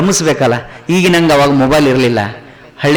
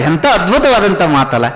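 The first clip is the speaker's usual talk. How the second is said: ordinary delivery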